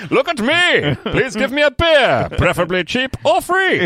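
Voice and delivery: high, loud, slow hammed-up plea; mock begging. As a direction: beg for beer in a silly high voice. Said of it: "And going".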